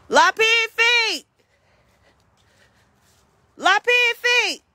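loud, high, slow holler; long, high hollered call. Real, not acted. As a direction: stretch the name out so it carries far. Hollering for the lost pig.

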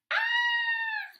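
very high, loud frog scream. Acted. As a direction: shriek as the frog jumps away. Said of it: "He screams".

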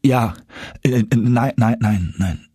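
stammering. A narrator's reading. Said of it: "stotterte ich".

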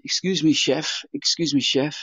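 lightly posh, polite English voice; a hint of upper-class politeness. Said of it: "this very posh voice behind me saying".

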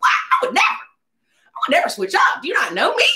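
hammed-up indignant yell; mock offended shout. Denies switching up to producer.

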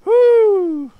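loud, high whoop of relief; triumphant whoop. Real, not acted. Player after escaping the monster, loud high whoop. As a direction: let out a big victory cheer.

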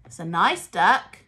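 ordinary talk, nothing marked

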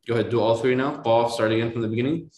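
normal talking voice

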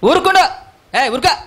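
high, loud mock scolding hush; imitating the crowd hushing. Preacher acts out the crowd silencing the blind man, with a loud high scolding hush. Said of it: "అని చెప్పారట".

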